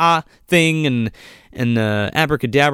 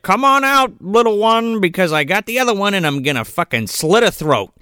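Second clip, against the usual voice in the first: high sing-song villain taunt, settling down; mock-menacing call up the stairs; taunting witch; 6 semitones higher than usual. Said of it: "she's like".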